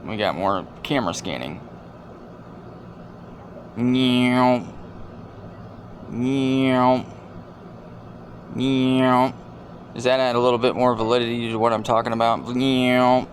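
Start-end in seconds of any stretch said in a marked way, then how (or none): 3.8-9.3 meowing like a cat; a put-on cat meow; cartoon cat noise; mock meow. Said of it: "Sound effects". 12.5-13.3 mock meow. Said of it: "Sound effects".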